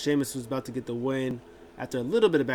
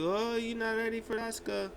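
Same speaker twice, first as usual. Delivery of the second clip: a higher-pitched mock taunt; taunting wrestler; 10 semitones higher, 3 dB quieter than usual. Asuka taunting her challenger, high and mocking. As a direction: tease her in a raised voice.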